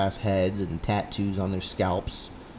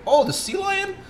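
mock brother voice; 4 dB louder than usual. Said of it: "he'll be like".

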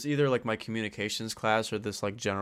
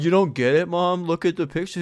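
loud, high retold plea to mom; exasperated insistence; 7 semitones higher, 9 dB louder than usual. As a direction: act out arguing with an uncomprehending parent. Acts out pleading with mom.

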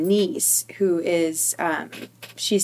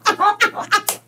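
higher, louder, tipping into a laugh; breaking into laughter; 12 semitones higher, 4 dB louder than usual. Real, not acted. Remembering the listener once told her that, breaking into laughter. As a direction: let real laughter take over by the end.

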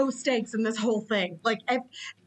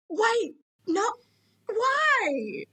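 high-pitched mock bewilderment; pleading disbelief, pitched way up; baffled questioning; 10 semitones higher, much slower than usual. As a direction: re-enact helpless confusion at a bad movie. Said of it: "multiple question marks".

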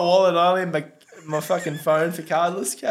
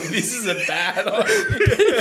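laughing; 6 semitones higher, 4 dB louder, slower than usual. Retold or imitated. Retold thought at door.

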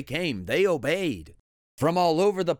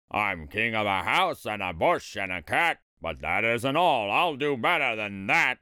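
low, imperious turtle king; thundering royal voice; 5 semitones lower than usual. A narrator's reading. Yertle puts lowly Mac in his place, imperious. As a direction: pull rank in a deep kingly voice. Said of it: "his royal voice thundered".

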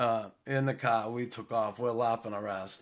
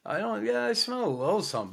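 mock innocent; 10 semitones higher, faster than usual. Harpo hiding laughter, playing innocent.

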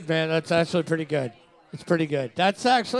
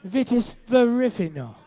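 badly faked English accent, a little higher; Harry Potter; 4 semitones higher than usual. Told to pretend he's the boy wizard, faked English accent. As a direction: attempt an English accent, stumbling over the Latin. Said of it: "English accent, pretend that you're Harry Potter".

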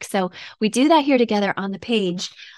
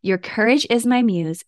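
normal talking voice

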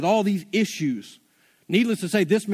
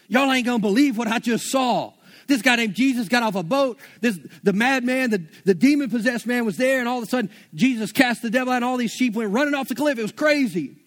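higher, breathless excited retelling; excited herdsmen; 4 semitones higher than usual. Acted. Herdsman running into town telling everyone what he saw, excited. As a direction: blurt out the news like you can't believe it.

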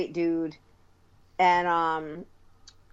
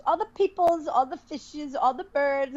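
put-on higher, playful Indian accent; imitating Indian English; 6 semitones higher than usual. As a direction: sing it out like the teacher. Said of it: "He goes".

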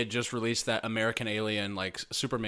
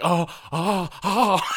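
rising, much higher, louder gasps of delight; excited squeals; 8 semitones higher, 8 dB louder, much slower than usual. Retold or imitated. Fan meeting his favorite podcaster, squealing with delight.

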